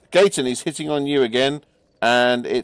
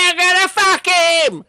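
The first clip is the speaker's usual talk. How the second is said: very high, loud war cry; high furious yell of a charging fighter; battle shout; 8 dB louder than usual. Acted. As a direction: scream it while charging. Stolas charging into attack.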